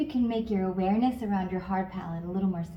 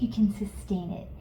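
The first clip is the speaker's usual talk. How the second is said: whisper; 4 dB quieter than usual. Real, not acted. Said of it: "whisper heard".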